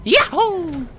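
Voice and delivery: swooping cartoon plumber, ending on a high whoop; sing-song game hero voice with a gleeful holler; Mario. Streamer's crude plumber confesses, then whoops, cartoon voice. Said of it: "I am Mario!".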